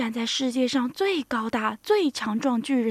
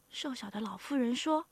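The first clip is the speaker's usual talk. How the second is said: plain reading voice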